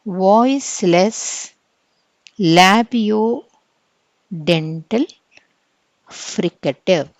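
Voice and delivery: voiceless labiodental fricative, each word drawn out very slowly; slow term drill. Shown as a demonstration. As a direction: spell out each term at dictation speed. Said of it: "it is a". (పదాలను చాలా నెమ్మదిగా చెప్పడం)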